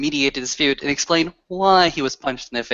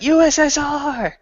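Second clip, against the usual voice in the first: crowd call-out; 13 semitones higher than usual. Acted. Kin calling out the next request after that, high mock shout. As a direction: holler the song title from the crowd.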